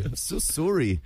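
lightly put-on Canadian sorry; mock Canadian accent. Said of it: "I think that was Canadian".

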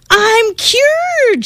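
elated shout; shouting with joy. Acted. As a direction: celebrate it aloud. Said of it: "she shouted".

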